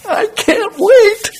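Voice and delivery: very high, slow mock-eager whine; mock eagerness. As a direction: drag it out like a resentful worker. Early worker imagined, sarcastic.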